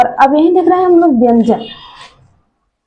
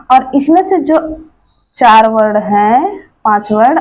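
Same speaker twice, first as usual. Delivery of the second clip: ordinary talk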